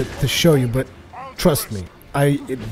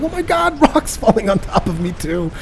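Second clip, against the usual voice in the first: panicked disbelief; 7 semitones higher than usual. Retold or imitated. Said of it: "I'm like".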